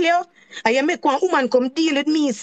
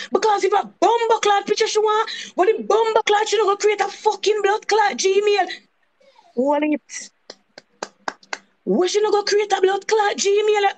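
higher, heated cursing rant; outraged rant; 7 semitones higher than usual. Real, not acted. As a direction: vent it, outraged. Venting outrage at the woman.

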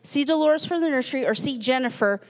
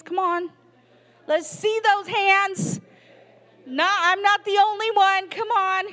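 playful pleading; 7 semitones higher than usual. Real, not acted. Pleading for volunteers, pitch up.

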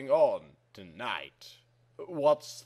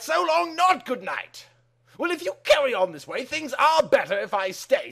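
high climax; 9 semitones higher, faster than usual. Acted. Climax of the recited lyrics.

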